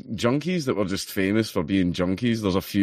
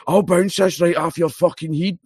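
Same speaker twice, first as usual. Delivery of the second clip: junkie threat; 8 semitones higher than usual. Retold or imitated. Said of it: "he literally went".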